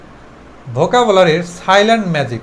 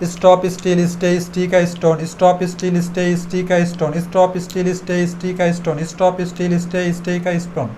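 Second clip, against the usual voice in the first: monotone chain of words, no pauses, getting softer; one-breath drill; 3 dB quieter than usual. Shown as a demonstration. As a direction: run the list round and round on one breath. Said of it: "একদমে পাঁচবার বলো".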